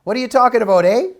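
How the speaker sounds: put-on Canadian accent with a teasing "eh". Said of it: "I loved hearing your Canadian accent".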